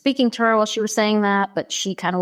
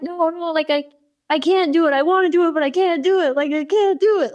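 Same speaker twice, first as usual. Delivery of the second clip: high, wailing mock child protest; pitched-up, sing-song pleading; retold emotional upset; 9 semitones higher than usual. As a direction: imitate a distraught kid insisting they can't. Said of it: "just really emotionally upset".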